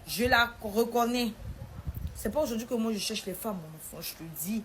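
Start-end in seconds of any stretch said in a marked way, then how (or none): 0.1-1.3 higher and louder, then slow and emphatic; stern father insisting he knows; father's raised voice. Retold or imitated. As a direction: lay down the law loudly. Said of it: "Il dit".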